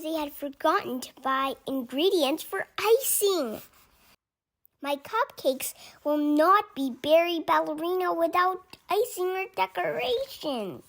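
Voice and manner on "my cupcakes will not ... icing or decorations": high, loud storybook complaint; upset protest, pitched up; dismayed. A narrator's reading. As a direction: wail it lightly, like an upset character. Angelina upset over missing icing.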